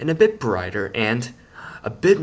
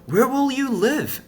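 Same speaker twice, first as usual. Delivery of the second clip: much higher, worried little voice; Splint asking; 5 dB louder than usual. A narrator's reading. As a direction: ask with real alarm. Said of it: "asked Splint".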